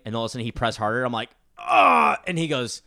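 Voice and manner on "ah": loud, high cry of pain, acted out; pained yelp. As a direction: yelp as if jabbed hard. Retelling a friend pressing harder.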